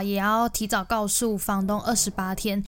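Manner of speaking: usual speaking voice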